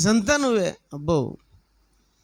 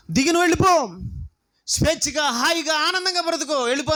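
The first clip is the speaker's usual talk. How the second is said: kind master setting him free; 5 semitones higher than usual. Acted. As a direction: send him off freely, a touch grand. Generous master frees the servant.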